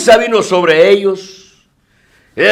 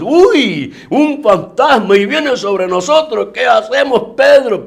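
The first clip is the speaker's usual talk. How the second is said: put-on frightened children; 7 semitones higher, slower than usual. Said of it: "al gritar como niños".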